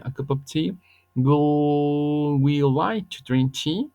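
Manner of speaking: English model questions read slowly with a rising end; teacher models each question slowly, rising at the end; slow demo questions. Shown as a demonstration. Teacher reading example questions to students, slow and rising. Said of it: "muevo el will al principio y digo".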